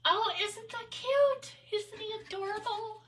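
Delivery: high-pitched adoring coo. Real, not acted. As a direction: gush sweetly, way up high. Cooing over a cute item.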